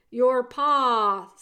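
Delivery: loud, drawn-out call; shouted. A narrator's reading. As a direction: shout it for someone hard of hearing. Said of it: "shouted the soldier".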